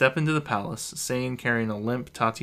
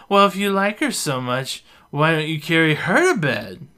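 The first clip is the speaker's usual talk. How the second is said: loud, higher jealous yell; drunken yell; 6 semitones higher, 8 dB louder than usual. A narrator's reading. Tipsy Beth yelling at Reuben in the hall, loud. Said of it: "Beth yells".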